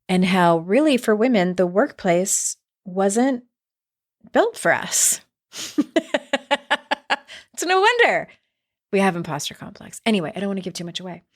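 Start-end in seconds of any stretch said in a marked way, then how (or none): 5.6-7.2 short laugh. Real, not acted. Laughs at own remark.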